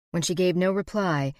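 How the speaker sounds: usual speaking voice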